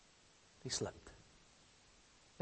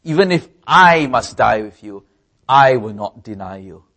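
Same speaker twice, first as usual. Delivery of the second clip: louder, confident boasting vow; proud vow; 8 dB louder than usual. Retold or imitated. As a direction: promise it proudly, chest out. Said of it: "proudly said".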